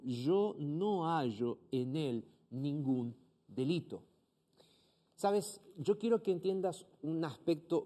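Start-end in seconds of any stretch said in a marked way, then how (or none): 0.0-4.0 slow, lightly voiced Pilate declaring; a hint of Pilate, slow and deliberate. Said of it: "les dijo".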